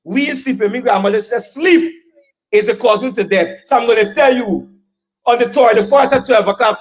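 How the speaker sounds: normal talking voice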